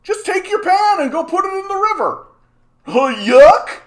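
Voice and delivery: loud, very high yokel caricature; hammed-up mocking falsetto; mocked clueless guy. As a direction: holler it like a clueless fool. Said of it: "is a guy saying".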